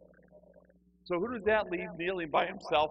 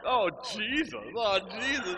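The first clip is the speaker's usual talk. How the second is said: louder, higher call to Jesus; mock fervent cry; 7 semitones higher, 8 dB louder than usual. Scared newcomer kneeling beside her, loud mock-fervent cry. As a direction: copy the fervent lady, big and loud. Echoes the lady in panic.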